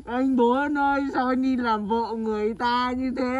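slow, drawn-out mock lament; hammed-up crying. Lamenting to Bốn that he became someone's wife, theatrical crying. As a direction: wail melodramatically, stretching the last words. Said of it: "Khóc dữ vậy".

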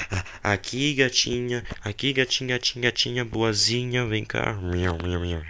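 soft hushed sweet-talk, "miau" cooed; coaxing the cat. Acted. Lloyd softly coaxing the giant cat Meowth closer. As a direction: lure the kitty in gently.

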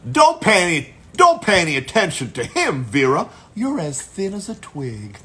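big animated ox character voice. Acted. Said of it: "I play an ox".

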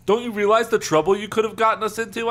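loud, high, exasperated cry; acted indignant outcry. A narrator's reading. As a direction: raise the voice in alarmed reproach. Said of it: "I cried".

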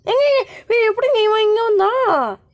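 high, startled exclamation; startled disbelief. Real, not acted. Gamer to viewers on finding the baby moved, startled disbelief. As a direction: cry out in baffled alarm. Baby suddenly appears in game.

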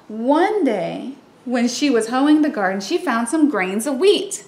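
usual speaking voice

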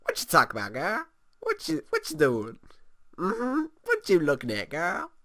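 lightly put-on sassy voice rising on girl; sassy sim voice. Gamer voicing a stressed sim, lightly sassy retort. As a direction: give him a bit of attitude. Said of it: "He's like".

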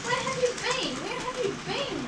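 high-pitched mild scolding; mildly cross. A narrator's reading. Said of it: "asked the coaches crossly".

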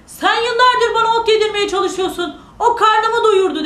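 high, loud indignant protest; dog shouting back. Acted. Dog refuses the giant who starved it, shouting. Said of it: "diye bağırmış".

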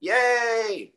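high cheerful yay; real excited fanfare for the guest. Real, not acted. Hyping up the guest intro.

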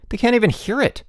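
high, exasperated retort; yelled. A narrator's reading. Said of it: "Bruno yelled".

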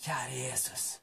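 barely audible whisper; hushed, voiceless demonstration; whispered prayer. Shown as a demonstration. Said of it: "så bare hvisker du".

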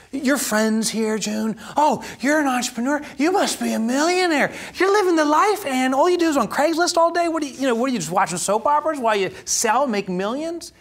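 high, teasing mock-friend voice. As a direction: tease like clueless friends imagining riches. Friends mocking entrepreneur myth.

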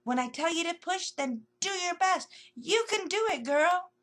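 coaching the squirrel to push; loud encouragement. Acted. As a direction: cheer her on loudly. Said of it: "talking loudly to the squirrel".

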